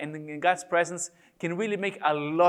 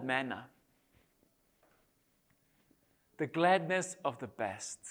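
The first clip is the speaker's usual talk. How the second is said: slow poem reading. Shown as a demonstration. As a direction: let long silences separate the images. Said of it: "I'm gonna read them slowly".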